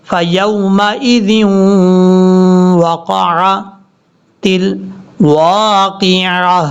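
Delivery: drawn-out chanted tajweed reading; slow Quran recitation. Shown as a demonstration. Teacher reciting verse slowly for students. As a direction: recite slowly, stretching each sound.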